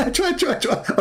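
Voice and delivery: high squeaky mock sneezes; hammed-up sneezing; fake sneezes.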